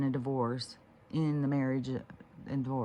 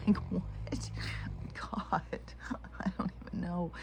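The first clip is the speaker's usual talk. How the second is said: whispered disbelief; 4 semitones higher, 5 dB quieter than usual. Real, not acted. Bewildered aside after the dream.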